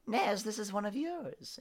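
high, sly mocking taunt; villain's mocking voice. Villain taunting the cursed player, high and mocking. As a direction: sneer down at the cursed one.

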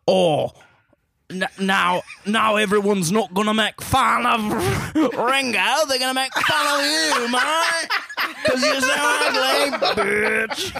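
put-on over-the-top British accent, cracking up. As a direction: sneer it like a Cockney dream killer mate. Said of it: "can you do a British accent".

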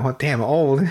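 usual speaking voice

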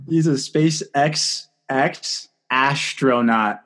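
slow, drawn-out "SpaceX X astronaut", with "ASH" opening the last word; savouring the pun. Real, not acted. Podcaster repeats a co-host's pun, slowly savouring "ASH-tronaut". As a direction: stretch each part of "SpaceX X astronaut", landing on "ASH-tronaut".

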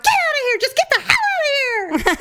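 high, loud retold shout; irate ordering out. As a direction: imitate someone angrily chasing them off. Acted order to leave.

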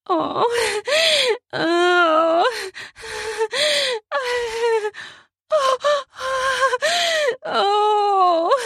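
repeated high, drawn-out moans; high moans with breaths between; high moaning. Acted. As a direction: moan far above your speaking pitch. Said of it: "as I moan".

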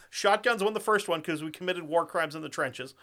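ordinary talk

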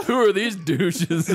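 high, slow sneering question; mock disdain. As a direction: sneer at the strangers. Germans' reaction, retold.

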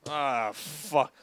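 normal talking voice

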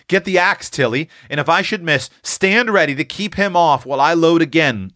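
put-on keyed-up young hunter; urgent older brother. A narrator's reading. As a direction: instruct her with pressing urgency. Eph gives urgent orders to Tilly.

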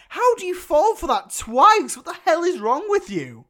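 high, loud, incredulous outburst; exasperated berating. Acted. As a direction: tell him off in loud disbelief. Mock berating of fallen hero.

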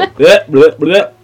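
disgusted bleh. Real, not acted. Disgusted at own mistake.

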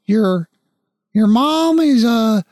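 can't bring himself to say it; hesitant father. Acted. Father breaking news about the mother to his son, high and halting.

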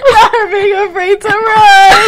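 loud, high, slow laughing shriek; tickled, shouted reaction; laughing outburst. Real, not acted. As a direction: crow it out through real laughter. Listener cracks up at the story.

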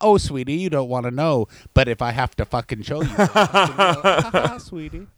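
low sugary threat, laughing through it; mock sinister granny; menacing old lady. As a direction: sweetly threaten the girl. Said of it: "Moolah voiced menacingly".

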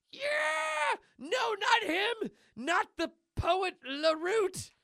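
very high cartoon shriek; hammed-up, slow wailing dread; horrified wail. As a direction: drag out the words in mock horror. Cheese horrified at being tagged.

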